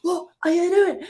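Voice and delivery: high, hammed-up scolding of the sun; mock angry shout. Rower scolding the sun for the glasses, mock angry and high. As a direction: tell the sun off like a cartoon grump. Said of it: "I could just shout".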